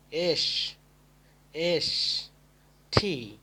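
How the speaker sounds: ordinary talk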